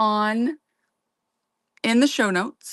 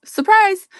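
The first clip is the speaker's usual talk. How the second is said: high playful reveal; playful surprise; 11 semitones higher, 6 dB louder than usual. Real, not acted. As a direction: squeal the surprise.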